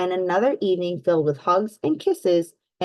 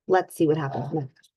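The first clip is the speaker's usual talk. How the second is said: usual speaking voice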